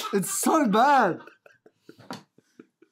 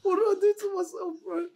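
pained whine; 13 semitones higher than usual. Real, not acted. Garlic fumes burning his eyes.

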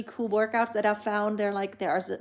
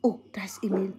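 whisper; 4 semitones lower, faster than usual. Real, not acted. Showing baby his reflection.

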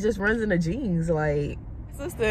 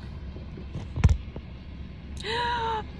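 high delighted gasp; excited gasp; 3 dB quieter than usual. Real, not acted. Excited at the baby.

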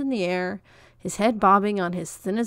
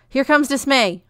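higher and louder, urgent; alarmed warning; 6 semitones higher, 9 dB louder than usual. A narrator's reading. Declaration warning the girls of the dog, urgent and loud.

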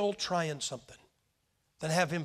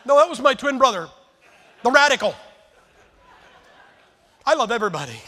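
loud and higher, laughing through the punchline; laughing joke; 6 semitones higher, 12 dB louder, faster than usual. Real, not acted. Preacher jokes to the congregation about his twin, laughing loudly. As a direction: tease them with a big laughing denial.

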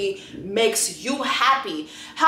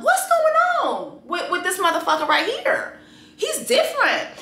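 much higher pitch, rising in disbelief; incredulous squeal; 8 semitones higher than usual. Real, not acted. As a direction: squeak it out in shock.

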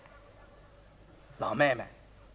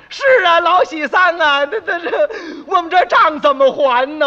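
crying laugh; 7 semitones higher, 6 dB louder than usual. Acted. Brothers cry over their debts.

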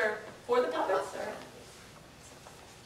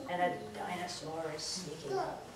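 spooky hush; 6 semitones lower, 12 dB quieter than usual. Acted. Teacher builds a scary story.

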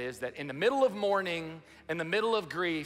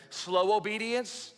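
higher, slow motherly saying; quoting mom; 5 semitones higher, 3 dB louder, slower than usual. Retold or imitated. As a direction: spell out mama's rule, slowly. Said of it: "That's such a mom thing to say".

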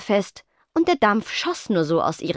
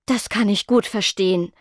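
plain reading voice

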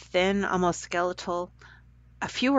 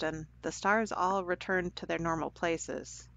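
ordinary delivery

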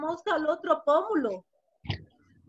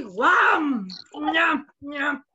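gobbling noises; 3 dB louder, much slower than usual. Acted. Storyteller imitates Aníbal eating the fly, gobbling. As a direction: snap it up, then munch.

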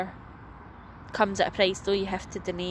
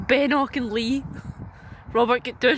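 calling out; 4 semitones higher, 5 dB louder than usual. Real, not acted. Calling child off climbing frame.

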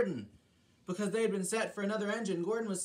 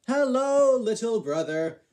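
greeting voice; 5 semitones higher, 8 dB louder than usual. Acted. Scotsman greets Gordon.

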